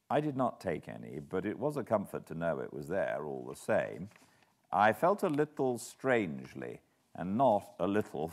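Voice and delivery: usual speaking voice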